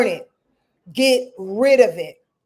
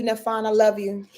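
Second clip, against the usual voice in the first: normal talking voice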